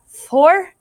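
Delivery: weary shocked echo; incredulous dismay. A narrator's reading. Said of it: "God sighed".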